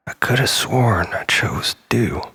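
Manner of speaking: ordinary delivery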